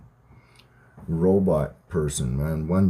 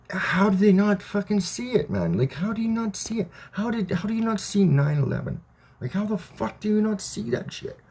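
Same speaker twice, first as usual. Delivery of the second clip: incredulous; 13 semitones higher than usual. Real, not acted. Ranting at blind people.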